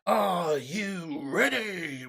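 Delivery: very slow ring-announcer stretch; slow, drawn-out announcer hype; drawn-out hype call. Shown as a demonstration. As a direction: stretch every word like a fight announcer. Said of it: "are we ready?".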